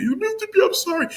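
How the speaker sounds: very high-pitched whimpering apology; mock tearful begging. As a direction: plead like a crying child, exaggerated. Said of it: "even us begging".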